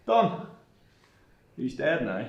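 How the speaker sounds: ordinary delivery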